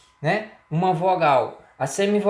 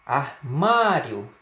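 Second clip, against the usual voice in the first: "Armário" drawn out slowly, each syllable stretched; slow repeat; 2 semitones lower, much slower than usual. Shown as a demonstration. Teacher repeating the example word slowly for students. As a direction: spell out "Armário" slowly for the class. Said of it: "a palavra armário".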